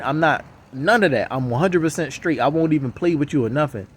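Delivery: usual speaking voice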